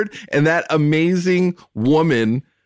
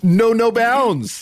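mock crying; 6 semitones higher, much slower than usual. Mock crying, calling episode.

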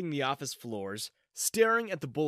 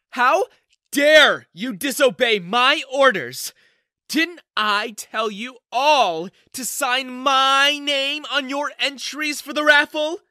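much higher, much louder, slow furious tirade; livid screaming; 11 semitones higher, 11 dB louder, slower than usual. Acted. Office manager screaming at the janitor who won, livid. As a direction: scream every word in rage. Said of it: "She was livid".

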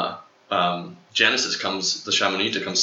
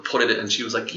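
usual speaking voice

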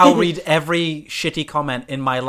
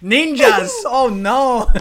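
loud hammed-up yell; mock shout; 7 semitones higher, 7 dB louder, much slower than usual. Mock Bruce Lee shouting.

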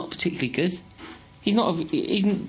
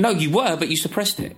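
ordinary talk, nothing marked

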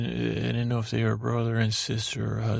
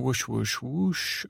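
airy imitated whoosh, repeated; whooshing sound effect; 2 semitones higher, slower than usual. Teller voicing the god magic sweeping away, whooshing. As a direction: blow out the whoosh like a magic gust.

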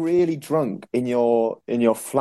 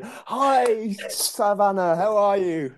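bright, much higher hello; cheery greeting; 9 semitones higher, much slower than usual. Retold or imitated. Greeting her date after secretly checking his name, higher and sing-song. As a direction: sell a breezy hello, hiding the panic.

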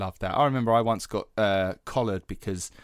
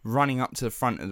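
ordinary talk

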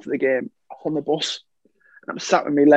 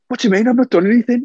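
higher and louder, acting the innocent; mock-innocent protest; 7 semitones higher, 6 dB louder, faster than usual. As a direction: play the wronged player, loud and high. Retold protest to the referee.

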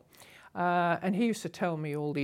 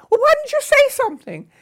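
much higher and louder, imitating a shrill reproach; mock scolding hostess; 12 dB louder than usual. Hostess scolding her guest about the snake, mock high indignation. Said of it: "And she said".

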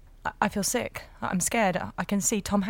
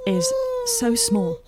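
tender awe; 4 dB louder than usual. Acted. Marvelling at tiny foot.

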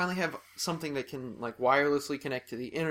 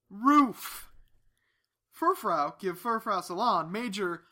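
cheesy commercial pitch, higher; ad read; 5 semitones higher, slower than usual. Pretend. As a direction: pitch the salon like a TV ad. Mock ad for Furfrau salon.

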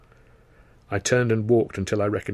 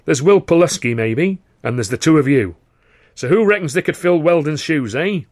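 loud, higher, mocking old impresario; boisterous theatre boss; 5 semitones higher, 7 dB louder than usual. Karno scoffing at Weldon to the boys, loud and high. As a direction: roar it like a gleeful manager.

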